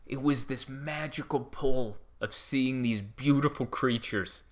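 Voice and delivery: ordinary talk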